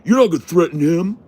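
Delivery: high, loud mock yell of the hillbilly. Hillbilly defending his friend in chat, mocking high yell. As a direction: squeal it out like a riled hillbilly defending his buddy. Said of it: "start saying".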